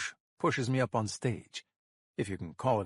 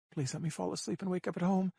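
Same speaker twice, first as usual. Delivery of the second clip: tearful prayer; 6 semitones higher than usual. A narrator's reading. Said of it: "she prays".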